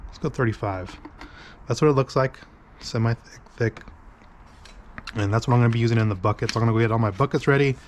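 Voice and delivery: usual speaking voice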